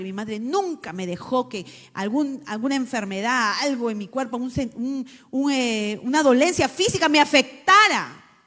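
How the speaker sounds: normal talking voice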